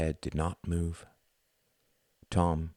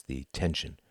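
ordinary delivery, nothing marked

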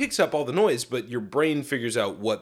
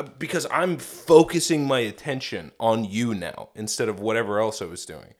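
ordinary talk, nothing marked

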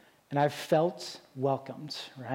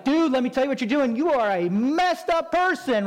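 higher, louder harsh critic lecturing; mock yelling critic; 7 semitones higher, 4 dB louder than usual. Preacher imitating a critic yelling at a lifter, higher and louder. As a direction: bark it out like a know-it-all gym critic. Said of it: "the other guy that's like".

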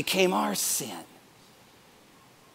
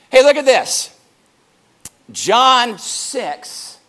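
normal talking voice

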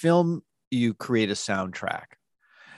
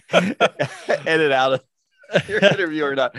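talking through a real laugh, pitched up and louder; amused, higher and louder with laughter; 7 semitones higher, 7 dB louder, slower than usual. Real, not acted. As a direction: laugh your way through the words. Joking aside about editing out.

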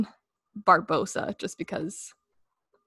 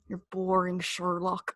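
Moriarty accent; 2 semitones lower, 6 dB quieter, slower than usual. Shown as a demonstration. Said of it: "The way he says his R's".

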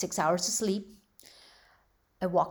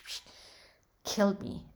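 ordinary talk

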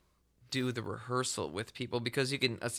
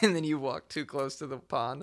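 normal talking voice

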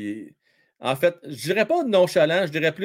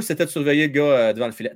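ordinary talk

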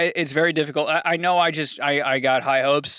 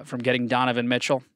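usual speaking voice

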